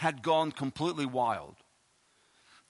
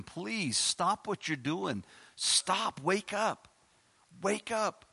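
begging plea; 4 semitones higher, much slower than usual. Acted. Begging people to wake up.

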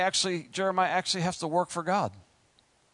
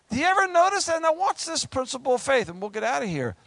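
usual speaking voice

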